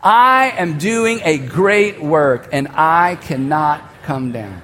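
slow, deliberate, each word weighted; slow, steady declaration said together; slow group declaration. Shown as a demonstration. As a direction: lead the room in a solemn slow chant. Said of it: "say this out loud together".

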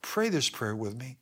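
normal talking voice

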